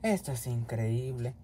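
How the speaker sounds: low, slow, soft grumble; slow, defeated undertone; weary disbelief. Acted. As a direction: grumble to yourself, fed up. Exasperated cellmate.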